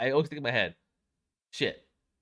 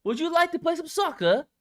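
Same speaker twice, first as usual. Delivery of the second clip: high, loud hammed-up British voice; mock British; 10 semitones higher, 9 dB louder than usual. Friends mocking the British over soccer, hammed-up British accent. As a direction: play a posh, outraged Englishman. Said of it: "British impression exchange".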